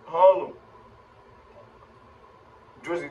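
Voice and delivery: right after a drag; smoky breath through words; talking while exhaling smoke. Real, not acted. Seen 0: Drag at +0.1, then smoke leaves his mouth while he speaks.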